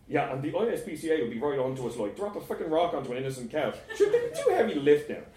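fast mocking retort, climbing high and loud; scornful sarcasm. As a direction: pour scorn on the plan, getting exasperated. Scornful retort to friend's plan.

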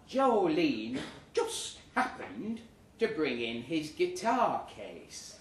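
usual speaking voice

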